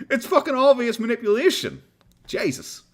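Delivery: attempted New York accent, high and exasperated; high-pitched, slightly off New York accent. Real, not acted. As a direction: exclaim in a rough try at New York. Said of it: "Decent New York accent".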